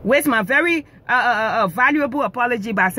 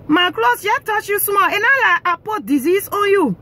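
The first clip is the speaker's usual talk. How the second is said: usual speaking voice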